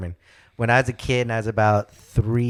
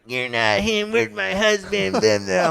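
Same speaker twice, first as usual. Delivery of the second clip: grumpy fiancée imitation; 9 semitones higher than usual. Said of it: "she was the grumpiest".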